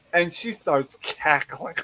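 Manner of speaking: usual speaking voice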